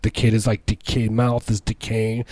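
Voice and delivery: normal talking voice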